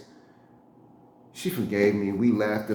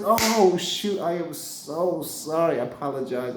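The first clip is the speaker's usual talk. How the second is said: high sudden oh, then slow sorry; embarrassed apology; 5 semitones higher than usual. Retold or imitated. Apologizing to Scarface's wife, embarrassed and slow. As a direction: caught out, backpedaling.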